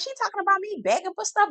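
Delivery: high-pitched, quick incredulous retort; mock indignation. Cast member answering a begging accusation, mock indignant. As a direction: put on a high, offended voice.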